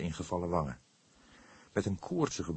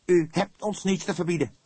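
much higher and louder, cutting; sharp retort; 12 semitones higher, 8 dB louder than usual. A narrator's reading. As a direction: snap back at him hard. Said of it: "sprak hij scherp". (scherp en luid, Vledder valt uit)

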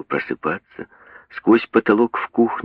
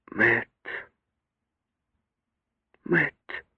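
whispering; 3 semitones higher, 6 dB quieter than usual. A narrator's reading. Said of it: "шепнула она".

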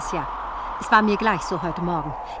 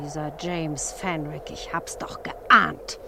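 whispered; 2 semitones lower, slower than usual. Acted. Muttering to herself, foreboding.

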